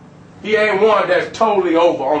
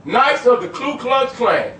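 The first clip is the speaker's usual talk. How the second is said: ordinary delivery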